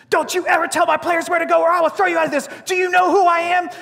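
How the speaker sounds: high, loud mock chewing-out; imitated escalating tirade. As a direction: act out a furious coach in someone's face. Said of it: "Escalating the conversation".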